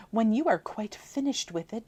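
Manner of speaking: usual speaking voice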